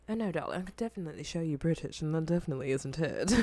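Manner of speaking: put-on low, quiet British accent. Teasing chat about being British, in a British accent. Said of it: "Said [name] being British".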